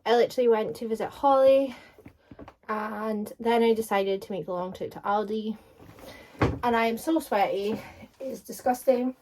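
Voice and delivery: panting and loud after the long walk; out of breath. Real, not acted. Back home from the walk to Aldi, talking out of breath. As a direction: puff the words out, winded and sweaty. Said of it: "I'm so out of breath".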